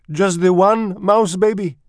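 put-on high, loud, incredulous French-accented voice; French mother mouse. A narrator's reading. Mother mouse questions father about the single baby, high disbelief. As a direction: ask it as a dismayed little French mother. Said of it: "She was a French mouse".

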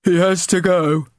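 high, cold and flat-out; cold bully. Acted. Bully boy deciding the old man must leave, cold. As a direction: say it coldly as a verdict. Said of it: "Ginger said coldly".

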